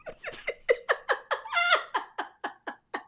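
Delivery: high squealing laugh, out of control; helpless laugh. Real, not acted. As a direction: crack up completely, high and breathless. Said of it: "they got me in tears".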